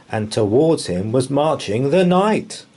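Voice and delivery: plain reading voice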